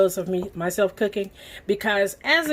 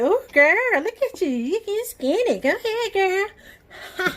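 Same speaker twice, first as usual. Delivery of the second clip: high, sassy imitation of mom; mom's voice; 12 semitones higher than usual. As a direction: hype her up like her proud mother. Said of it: "And then my mom's like".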